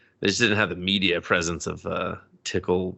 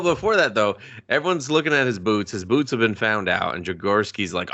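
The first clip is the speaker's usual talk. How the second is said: ordinary talk, nothing marked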